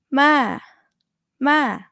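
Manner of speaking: open-throated fall on "Ma". Shown as a demonstration. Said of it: "focus on your throat, it's open".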